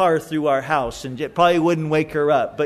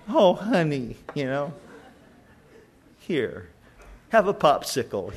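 soothing mom; 5 semitones lower than usual. Acted. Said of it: "mom's like".

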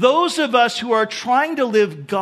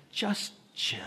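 "Just chill" whispered, slow and easy; cool whisper; 13 dB quieter than usual. Shown as a demonstration. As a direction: breathe it out like the coolest guy in the room. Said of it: "in the coolest way as you can".

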